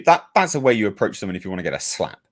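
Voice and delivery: usual speaking voice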